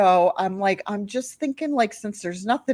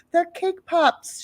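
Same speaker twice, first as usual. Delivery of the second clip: high, slow mom voice; mom to kids; 10 semitones higher, slower than usual. Retold or imitated. Mom tells kids about treats, singsong parent. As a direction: explain it high and slow to little ones.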